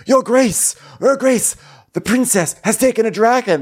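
high-pitched frantic report; hammed-up urgent squeal; urgent messenger. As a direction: raise a mock alarm to the king. Imagined messenger alerting the king.

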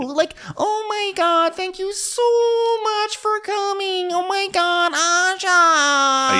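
squeaky falsetto, slow and sugary; very high-pitched. Retold or imitated. Jenny greeting Aja, very high-pitched falsetto. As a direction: gush up in a tiny high voice. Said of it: "very high-pitched".

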